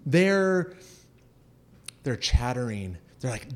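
ordinary talk, nothing marked

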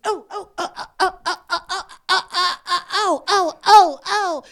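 high, loud string of mock panicked ohs, half laughing; flustered stranger. Woman learning the toddler took her money, high flustered ohs. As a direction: ham up a flustered woman caught off guard. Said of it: "And she goes".

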